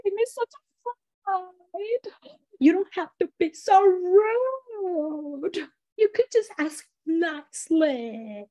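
high monster voice with long stretched vowels; belly button monster. Shown as a demonstration. Storyteller shows how her story's monster talks, belly button monster voice. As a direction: open the mouth wide and draw the words out high. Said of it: "but he talks like this".

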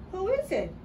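plain reading voice

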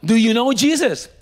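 baffled man repeating the question; incredulous echo. Retold or imitated. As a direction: throw the question back in disbelief.

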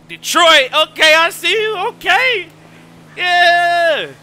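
hyped greeting. Real, not acted. Streamer hyping city shoutouts.

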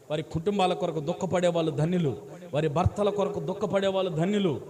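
builds from steady to loud preaching, stressing each family word; a repeated refrain swelling into full preaching; building sermon refrain. Acted. Preaching rising in force.

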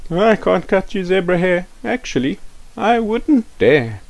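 auntie's voice. Acted. Auntie refusing Rafiki's request, high hesitant voice. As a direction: back off with a nervous laugh in the voice.

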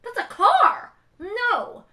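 high, loud shocked cry at the price; outraged shout. Retold or imitated. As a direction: yell in disbelief at sixteen thousand. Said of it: "I'm like".

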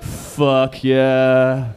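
loud, slow, sarcastic cheer; mock student enthusiasm. Comic mocking returning students, loud slow fake excitement. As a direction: drag it out like a bored student faking hype. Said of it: "Everyone's like".

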